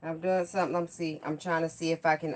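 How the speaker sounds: ordinary talk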